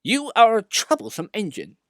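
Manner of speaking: high, loud stern scolding; the controller scolding a rude engine; severe. A narrator's reading. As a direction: tell him off sternly. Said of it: "was severe with Sir Handel".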